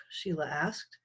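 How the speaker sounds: plain reading voice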